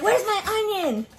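high, drawn-out retold complaint; whiny demand. Little sister demanding onion from older sister, high whiny complaint. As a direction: whine it high and stretched out.

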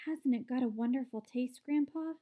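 ordinary delivery, nothing marked

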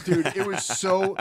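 ordinary delivery

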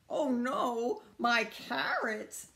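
crying out. Acted. Rabbit loses the carrots in the collapse, dismayed cry. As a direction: lament it with drawn-out words. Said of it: "cried Rabbit".

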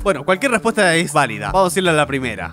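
ordinary talk

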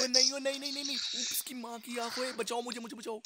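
high, frantic cries for help; panicked pleading, pitch up; panicking gamer. Real, not acted. Enemy chasing, begging for help.

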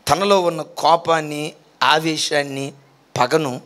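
ordinary talk

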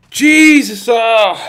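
jumpy, high, loud fright; startled scream. Real, not acted. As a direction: yelp it in real fright. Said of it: "That really did scare me".

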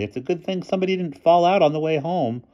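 put-on high girlish voice; calling out. A narrator's reading. Girl covers for the escaped boys, high voice. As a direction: carry on the excuse, pitched well up. Kathy calls an excuse to headmistress.

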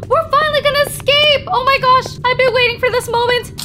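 high, quick burst of excitement; elated, high-pitched rush; excited. Real, not acted. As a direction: rush it out, thrilled to escape. Escape train found at last.

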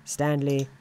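ordinary delivery, nothing marked

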